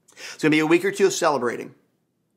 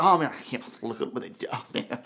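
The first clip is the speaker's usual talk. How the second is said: mock disdain; 7 semitones lower, 7 dB quieter than usual. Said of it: "you look down your nose at them".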